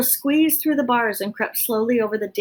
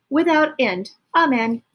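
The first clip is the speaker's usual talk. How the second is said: mock sermon; 5 semitones higher, 5 dB louder than usual. Botticelli solemn finish.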